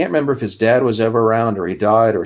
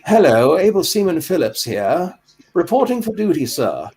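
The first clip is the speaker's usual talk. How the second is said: high-pitched Etonian RP, reporting in; plummy upper-class English accent, pitched well up; Leslie Phillips RP accent; 8 semitones higher than usual. Acted. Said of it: "some sort of Etonian R P accent".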